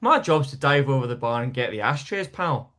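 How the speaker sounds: imitating a Scottish barman; matter-of-fact northern Scots voice; northern Scottish accent. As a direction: play the Scottish mate explaining his job. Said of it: "a bit further north Scotland".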